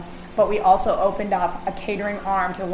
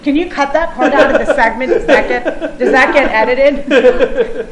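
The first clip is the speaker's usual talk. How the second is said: laughing; 4 semitones higher, 7 dB louder than usual. Real, not acted. Jokes about cutting the remark.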